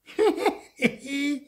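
high, loud mocking cackle; cackling laugh. A narrator's reading. Carved mouth laughing at its maker, high cackle. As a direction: burst into a cheeky high cackle. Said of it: "lachte der Mund".

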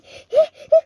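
shrill burst of laughter; startled squealing laugh; high squealing laugh. Real, not acted. Teammate killed, reacts.

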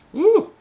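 high loud whoop of delight; excited whoop. Real, not acted. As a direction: whoop with joy. Whoop after sipping beer.

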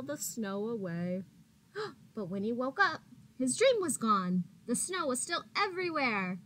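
gentle, expressive read-aloud voice; reading to children. A narrator's reading. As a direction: read it like a picture book for little kids.

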